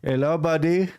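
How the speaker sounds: hammed-up Indian accent, eager cold caller. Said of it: "doing the Indian voice".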